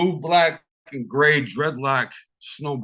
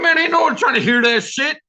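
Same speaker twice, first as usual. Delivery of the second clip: dismissive brush-off; 10 semitones higher, 5 dB louder, faster than usual. Retold or imitated. Brushing off his scared driver.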